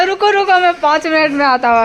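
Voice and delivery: high, loud filmy line delivery; over-dramatic dialogue. Retold or imitated. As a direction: deliver it like a hammed-up movie hero. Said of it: "उसका over dialogue मार रहा है".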